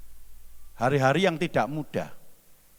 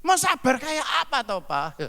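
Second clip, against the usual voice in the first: much higher, indignant retort as the wife; wife snapping back; 13 semitones higher than usual. Acted. Wife snapping back at husband in a higher voice. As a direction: fire it back at the husband, pitch jumping up. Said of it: "yang satunya juga naik juga, nadanya naik".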